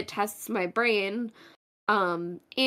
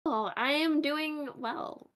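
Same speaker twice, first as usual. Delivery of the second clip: drawn-out, higher, hesitant claim to be fine; unconvincing; 6 semitones higher, 3 dB quieter, slower than usual. Real, not acted. Co-host answering how she's doing before the game, unconvincing. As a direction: stretch it out slowly, not quite convinced. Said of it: "that was not a very convincing".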